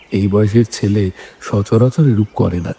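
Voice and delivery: usual speaking voice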